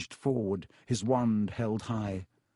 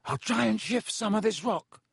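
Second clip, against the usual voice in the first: high, strained, controlled voice; tense effort at calm; trying to sound steady; 9 semitones higher than usual. A narrator's reading. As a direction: hold back the worry as you call. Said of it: "trying to keep his voice steady".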